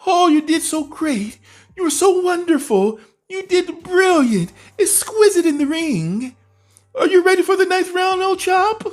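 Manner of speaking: hammed-up falsetto coaxing with a mock English "old chap"; mocking Ben Davison. Mocking Ben Davison sweet-talking Billy Joe in a hammed-up falsetto. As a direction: gush praise in a silly high voice, teasing. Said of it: "he's whispering sweet nothing in Billy Joe's ear".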